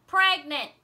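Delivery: loud high yell of one word. A narrator's reading. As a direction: blurt it out at full voice. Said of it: "yelled Ralph Herdman".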